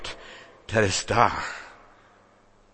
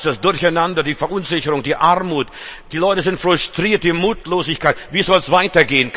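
loud, higher, urgent preacher's litany; full-force preaching; 5 semitones higher, 3 dB louder than usual. Acted. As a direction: hammer home every trouble. Preacher lists the world's troubles.

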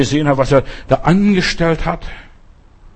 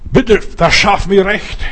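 pleading prayer; 5 semitones higher, 3 dB louder, slower than usual. Acted. Praying plea for justice.

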